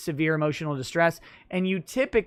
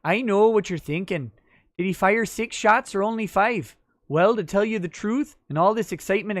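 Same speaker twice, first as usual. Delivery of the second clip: put-on higher-pitched lilting Fargo accent; 4 semitones higher, 3 dB louder than usual. Streamer doing a viewer's request for a movie speech, Fargo accent. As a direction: play the tough-guy speech in a singsong Fargo accent. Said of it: "in your best Fargo accent".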